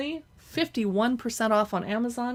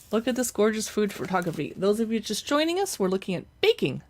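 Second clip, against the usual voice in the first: ordinary talk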